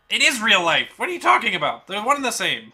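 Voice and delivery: loud, high exasperated arguing; heated shout. Real, not acted. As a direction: shout it back in disbelief. Arguing back in a heated quarrel.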